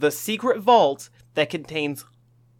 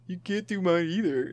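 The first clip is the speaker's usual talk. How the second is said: an uncanny copy of a friend's voice; slightly wrong copied voice; 2 semitones higher, 6 dB quieter than usual. Retold or imitated. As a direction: mimic your friend's voice closely. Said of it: "that was eerie".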